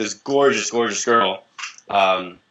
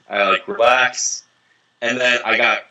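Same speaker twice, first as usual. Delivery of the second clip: usual speaking voice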